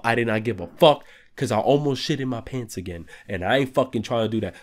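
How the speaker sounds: normal talking voice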